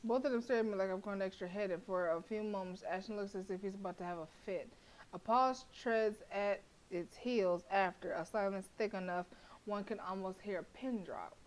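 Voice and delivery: measured narrator read; story narration. A narrator's reading. Narrator describing the tense silence, story voice. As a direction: set the scene like reading a novel.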